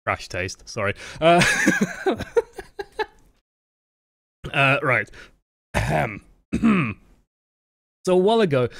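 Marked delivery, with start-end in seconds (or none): 1.5-3.0 sudden high-pitched giggle; high squealing laugh. Real, not acted. Streamer laughing at a donation message, high squealing laugh.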